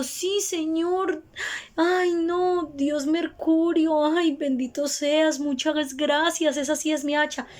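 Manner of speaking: put-on high, slow, pleading old peasant voice; grateful woodcutter. Woodcutter thanking the god Mercury for his axe, high and slow.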